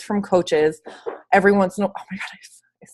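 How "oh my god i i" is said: sudden breathless whisper; startled whisper. Real, not acted. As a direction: gasp it out under your breath. Startled, breaks off mid-sentence.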